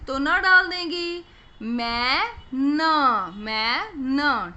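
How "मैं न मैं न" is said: "मैं न" split and drawn out very slowly, twice; sounding out. Shown as a demonstration. Teacher to kids, splitting the word into sounds. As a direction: break "मैन" into मैं and न, slowly. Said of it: "न की".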